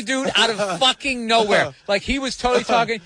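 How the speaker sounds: normal talking voice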